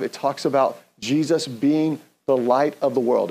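ordinary talk, nothing marked

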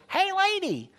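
very high parrot squawk. Acted. Parrot greeting the lady on the third day, very high squawk. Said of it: "the parrot sees her and says".

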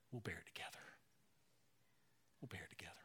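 soft, breathy whispered reassurance; hushed whisper. Retold or imitated. Church family reassuring the careless young pastor, whispered. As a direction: whisper it like a gentle promise.

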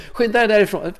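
high, hurried, laughing push to get going; urgent inner voice. Retold or imitated. His mind urging him off to the pub, high and laughing. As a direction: pitch jumps up as the mind nags him out the door, breaking into laughter. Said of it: "sen sa mitt mind".

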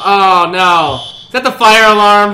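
loud alarmed shout; shouting over fire alarm. Acted. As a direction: yell over the blaring alarm. Acted alarm scene at start.

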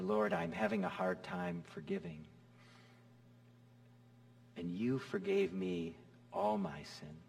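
soft, hushed pleading prayer; quiet prayer. Pretend. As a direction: pray it gently and quietly. Models a forgiveness prayer.

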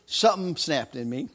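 ordinary talk, nothing marked

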